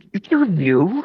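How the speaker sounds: a high-pitched mock posh princess question. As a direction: play a haughty princess, baffled. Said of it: "in a really posh princess voice".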